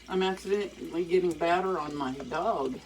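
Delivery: normal talking voice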